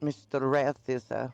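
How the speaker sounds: usual speaking voice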